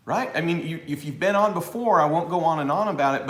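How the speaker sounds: normal talking voice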